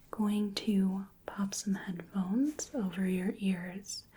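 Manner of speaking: ordinary talk, nothing marked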